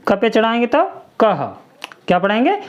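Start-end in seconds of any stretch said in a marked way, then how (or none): none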